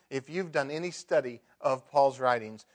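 ordinary talk, nothing marked

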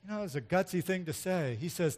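normal talking voice

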